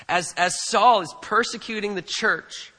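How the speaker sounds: ordinary talk, nothing marked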